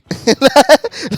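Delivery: loud high burst of laughter; real laughter, high and loud. Real, not acted. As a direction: crack up at the joke. Laughing at the lyric.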